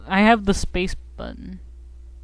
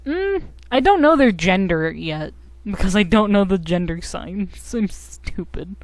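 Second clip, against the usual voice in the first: ordinary talk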